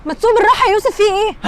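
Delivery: high, loud shout of alarm. Acted. Wife shouting at husband speeding the car. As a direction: yell at him to drive gently.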